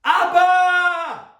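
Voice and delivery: a full-voiced shout of Abba to God; cry to heaven. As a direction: cry out to the Father with everything. Crying out to God.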